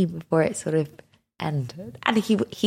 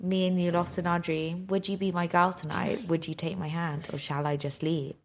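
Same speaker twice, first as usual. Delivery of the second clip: softer, fading mock-romantic reading; a hint of a soppy serenade; mock tender lyric reading; 3 semitones lower, 9 dB quieter than usual. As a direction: read the lyrics ever softer, half-crooning. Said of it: "sing it".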